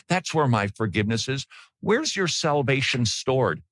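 usual speaking voice

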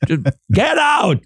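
mock-angry high-pitched outburst; openly aggressive. Host jokingly orders co-host to leave, mock aggressive. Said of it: "passive-aggressive without the passive part".

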